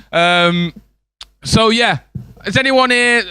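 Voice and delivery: ordinary talk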